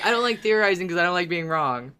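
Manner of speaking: quick, lightly put-on voice parroting a friend; mocking imitation. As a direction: tease her by quoting her own words back fast. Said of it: "You're always saying".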